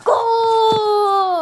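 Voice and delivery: shouting the goal out loud. Real, not acted. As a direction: yell it as the ball goes in. Goal scored in the game.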